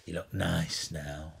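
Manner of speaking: low, slow, soft murmur. Retold or imitated. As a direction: drawl it like a weary rock legend. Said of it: "He went".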